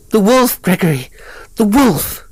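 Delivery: high, loud scream of terror; terrified shriek. A narrator's reading. Kathleen finding the dead beast, shrieking in terror. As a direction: shriek it out in sheer panic. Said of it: "He heard her shriek".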